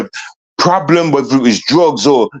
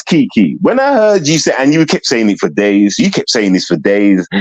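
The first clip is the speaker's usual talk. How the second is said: ordinary talk, nothing marked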